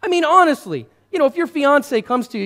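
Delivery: normal talking voice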